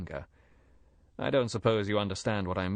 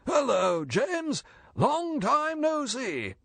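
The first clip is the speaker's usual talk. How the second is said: forced, much higher and louder jovial greeting; false heartiness; 4 dB louder, much slower than usual. A narrator's reading. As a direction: boom out a fake-hearty hello. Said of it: "with a heartiness that rang with a terrible falsity".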